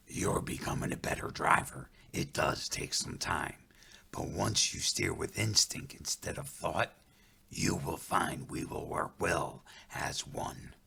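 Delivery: whispering spirit voice. Acted. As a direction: hush it like a wise guide. Running Bear whispers.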